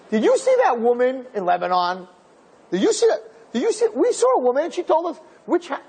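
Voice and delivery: high, rising, breathless questions; excited retelling. Retold or imitated. Soldier asking other units about the woman, excited and high. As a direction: pitch climbs as he urges them to listen.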